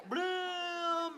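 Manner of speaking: high swooshing "bloom" sound; dunk sound effect. Pretend. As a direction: make the ball drop through the net.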